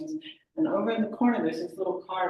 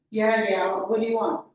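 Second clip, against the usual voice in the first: loud, impatient phone answer; brusque and raised in volume; brusque impatience; 3 semitones higher, 7 dB louder than usual. Acted. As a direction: cut the caller off impatiently. Acted brusque answer to caller.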